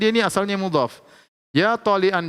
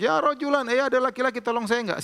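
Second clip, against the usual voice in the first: drowning man calling; 8 semitones higher than usual. Retold or imitated. A teacher acts a drowning man crying out for help, high and urgent. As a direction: call out for help like a man going under. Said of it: "dia berteriak".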